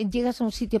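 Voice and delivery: usual speaking voice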